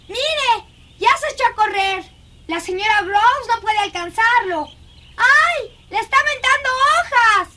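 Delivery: high, excited exclamations; excited commentary. Acted. Girl watches the boy run off, excited commentary. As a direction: follow the chase with high-pitched delight. Child watches chase in garden.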